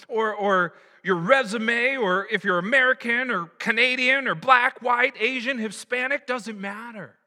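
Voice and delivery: high, loud, driving sermon voice; fired-up preacher on a roll; impassioned preaching. Real, not acted. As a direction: hammer out each category with rising fervour. Preacher builds to emphatic climax.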